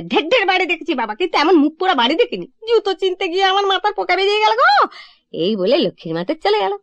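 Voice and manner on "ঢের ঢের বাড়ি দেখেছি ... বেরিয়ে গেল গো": the fed-up maid storming off; exasperated maid. Retold or imitated. Maid quits after the shoe mix-up, higher exasperated maid voice. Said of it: "লক্ষ্মীর মা দুম করে জুতো রেখে দিয়ে বললে".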